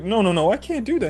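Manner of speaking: high-pitched mock protest; flustered refusal. Gojo refusing an invitation to stay over, flustered.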